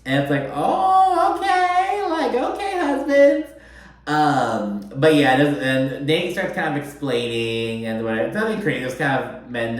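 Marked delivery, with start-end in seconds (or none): 0.5-3.5 much higher, exaggerated mock voice; mock exasperation. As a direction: roll your eyes at the husbands out loud. Mocking reaction to the husbands.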